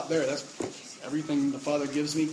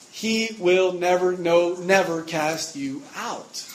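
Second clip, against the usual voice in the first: he will never, no, never, dragged out slowly with weight; slow emphatic never; 2 semitones higher, 4 dB louder, slower than usual. Shown as a demonstration. Preacher slowly stressing never for emphasis. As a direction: lean on each never, slowly. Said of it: "pretty emphatic".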